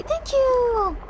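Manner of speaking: high, drawn-out singsong thank-you; gushing thanks. Real, not acted. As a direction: sing out your thanks way up high. Thanking a viewer for support.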